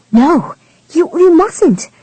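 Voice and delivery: high, startled cry of alarm; shocked protest. A narrator's reading. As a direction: gasp it out in shock. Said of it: "Her shocked gasp was a desperate whisper".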